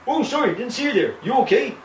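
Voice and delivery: put-on high, apologetic TV Canadian accent; caricatured Canadian politeness and accent; overdone Canadian accent. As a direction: imitate a sitcom Canadian's lilting accent. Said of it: "it's that accent".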